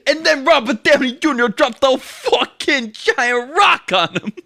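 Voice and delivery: very high, loud, slow hammed-up yell; mock bellow. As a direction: bellow it out, stretching each word. Narrating in the put-on mock voice.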